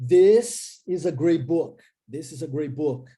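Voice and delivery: slow, deliberate "This is a great book"; slow model sentence. Shown as a demonstration. Teacher models the sentence about the book slowly. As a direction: spell it out slowly for the class. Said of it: "Posso falar do livro".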